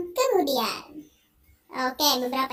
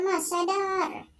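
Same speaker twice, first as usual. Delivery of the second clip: slow, higher drawn-out plea; pleading; 4 semitones higher, much slower than usual. Acted. Wife begging her husband to snap out of it, slow higher plea. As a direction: beg him to come to his senses.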